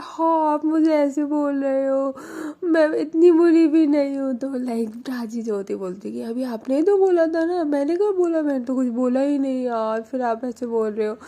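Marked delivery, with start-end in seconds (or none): 0.0-4.3 high, loud, fake-teary protest; mock whimpering. Mani hurt by remark. 6.2-11.2 playful bickering. Acted. Playful couple quarrel.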